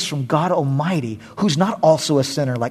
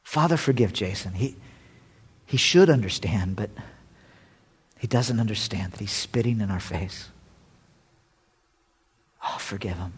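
soft mock prayer; 4 semitones lower, 5 dB quieter than usual. Prays for a hypothetical man.

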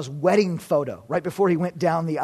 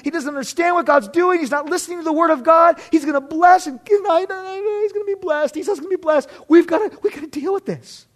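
high, rushed, flustered protest; hammed-up panic, tripping over the words; panicked wife; 12 semitones higher, 6 dB louder than usual. Rebekah frets that her husband will bless the wrong son, panicked. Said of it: "she is in panic mode".

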